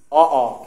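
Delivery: অ, অ opened too wide, low and loud; bad-sounding demo. Shown as a demonstration. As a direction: show how ugly an overdone অ, অ sounds. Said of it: "বাজেভাবে শোনাচ্ছে". (বাজেভাবে, বেশি হা করে 'অ, অ')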